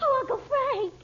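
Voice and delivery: high crying call; tearful cry. Acted. Betty crying out to her uncle in fear.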